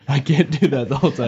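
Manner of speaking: ordinary talk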